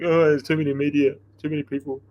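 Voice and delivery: lightly voiced old-timer complaint; grumbling old dog. Racer mimicking old dogs objecting to celebrity entrants, lightly grumbling. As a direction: grumble like a put-out veteran. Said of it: "The old dogs wouldn't have liked it".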